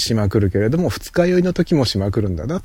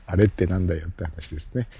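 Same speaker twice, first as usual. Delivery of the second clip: ordinary talk, nothing marked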